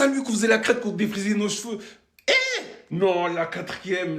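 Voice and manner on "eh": sudden high yelp of joy; a hyped-up high cry; excited shout. Real, not acted. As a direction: burst out cheering. Hyped football memory cheer.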